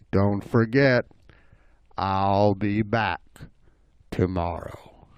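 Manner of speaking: low, slow parting threat; menacing bobcat. Acted. Bobcat leaving the animals, low lingering menace. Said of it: "he looked back and he said".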